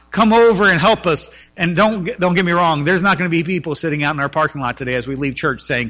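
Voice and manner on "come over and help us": crying out. A narrator's reading. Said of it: "this man's crying out".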